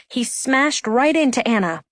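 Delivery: plain reading voice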